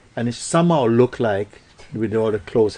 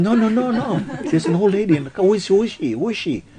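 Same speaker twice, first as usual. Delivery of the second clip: high, agitated, insisting she saw someone; pleading to be believed, pitched up; frightened insisting; 10 semitones higher, 3 dB louder, faster than usual. Acted. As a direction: panicky guest insisting on the old lady.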